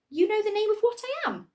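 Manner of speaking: high, loud, eager question; bursting with hope; excited. Acted. Hester asks the creatures eagerly, high and excited. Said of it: "Hester was so excited she started to quiver".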